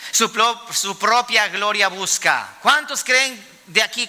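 normal talking voice

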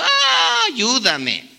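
hammed-up high wail, then a plea for help; long high-pitched mock cry falling away. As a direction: imitate a child coming in wailing. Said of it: "llegan llorando y diciendo".

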